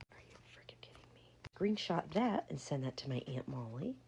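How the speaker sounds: hissed disbelief, barely voiced; whispered outrage. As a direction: whisper it in shock so nobody hears. [name] reacts to bribe, whispering.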